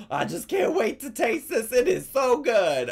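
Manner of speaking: high, loud, excited squeal; pumped up imitation. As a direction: gush like an overeager fan. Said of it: "she sounds like she is pumped".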